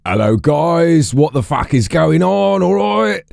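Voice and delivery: high, drawn-out Cockney greeting; Cockney accent. Acted. Streamer slipping into character while greeting chat, exaggerated Cockney. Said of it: "instead of talking to you as myself".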